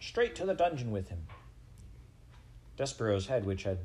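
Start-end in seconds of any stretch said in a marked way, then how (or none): 0.0-1.3 high-pitched condemning cry. A narrator's reading. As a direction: call for punishment from the crowd. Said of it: "a voice cried out".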